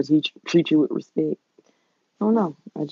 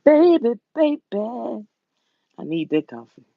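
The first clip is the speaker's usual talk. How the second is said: excited sing-song; 7 semitones higher, slower than usual. Real, not acted. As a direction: croon it, craving coffee. Craving coffee, cooing baby.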